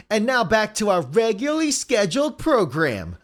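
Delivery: jokey program announcement; mock announcer. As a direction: announce it like a TV continuity voice.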